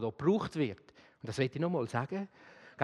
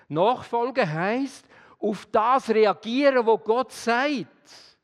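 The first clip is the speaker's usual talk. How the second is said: very slow, louder, higher, every word weighted; slow emphatic definition; 5 semitones higher, 6 dB louder, much slower than usual. Acted. Preacher hammering home what discipleship means, slow and loud. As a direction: spell it out word by word.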